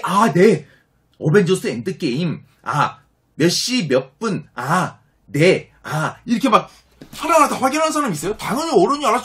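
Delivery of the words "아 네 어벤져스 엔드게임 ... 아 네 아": slow, drawn-out checking of "어벤져스 엔드게임", each word spelled out; imitating a clerk reading every detail aloud, slowly; mock fussy confirming. Clerk confirming every ticket detail to customers, slow mock-fussy read. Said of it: "사람들이 그 영화 하면서 그 자세히".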